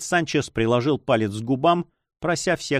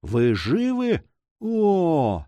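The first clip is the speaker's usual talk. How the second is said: slow, drawn-out disbelief; astonished cry; 6 semitones higher, much slower than usual. A narrator's reading. Young man finding his aunt alive, astonished cry. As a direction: stretch the question, then a high gasped 'oh'. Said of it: "вскричал он".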